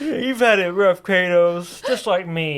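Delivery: high, loud mock-sympathetic gush to Kratos; gushing to a game hero as a fellow sad boy; mock self-pity. Mocking his sad self talking to Kratos, high and loud. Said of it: "being like".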